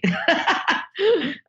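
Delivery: bright laugh up high, falling away; embarrassed laugh after confessing. Real, not acted. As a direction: laugh at yourself. Laughing at own film-school admission.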